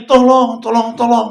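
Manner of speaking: loud, high yell for help; retold yell for help, loud and high; shouted cry for help. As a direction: shout for help across the street. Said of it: "Teriak-teriak lagi".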